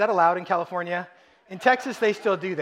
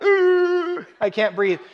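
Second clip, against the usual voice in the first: put-on choking kid; 12 semitones higher, 6 dB louder, much slower than usual. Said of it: "Just once I got to, like".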